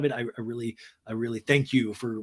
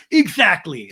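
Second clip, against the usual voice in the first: loud mock agreement; hammed-up, punched agreement; Sandoval; 4 semitones higher, 10 dB louder, slower than usual. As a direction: imitate an emphatic man agreeing loudly. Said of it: "And Sandoval's like".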